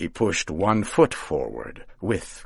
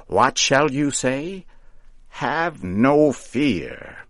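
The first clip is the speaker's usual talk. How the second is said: high, slow, louder; reassuring witch; 7 semitones higher, 4 dB louder, slower than usual. A narrator's reading. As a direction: soothe him, witchy and grand.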